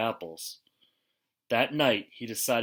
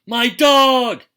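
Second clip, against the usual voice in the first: much higher, much louder cry of alarm; shout; 12 semitones higher, 13 dB louder than usual. Acted. Owner shouting as his dog floats away, alarmed shout. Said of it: "shouted the owner".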